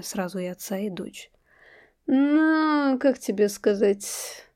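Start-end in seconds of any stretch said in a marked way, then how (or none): 2.1-4.5 slow, pondering drawl; thoughtful drawl. A narrator's reading. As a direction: stretch the words out, musing. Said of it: "задумчиво протянул".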